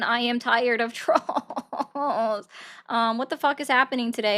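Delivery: playful exasperation, "trolls" drawn way out; slow, laughing complaint; exasperated. Real, not acted. Streamer groans about troll callers.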